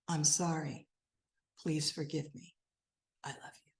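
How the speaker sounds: humble apology fading to whisper. Retold or imitated. Approaching others humbly, saying sorry, softening to a whisper. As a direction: let the apology sink down to a whispered I love you.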